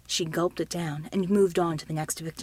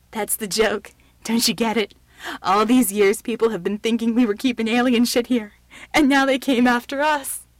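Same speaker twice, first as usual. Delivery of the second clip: hysterical; 2 semitones higher, 8 dB louder than usual. A narrator's reading. Shaken man before he cries, hysterical. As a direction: rave it, on the edge of breakdown. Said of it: "began to laugh again".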